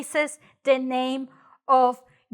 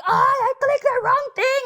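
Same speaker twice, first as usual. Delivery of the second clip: much higher, exasperated mock complaint; flustered phone user; 11 semitones higher than usual. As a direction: act out fumbling a tiny phone, high-pitched. Acts out fumbling with a phone.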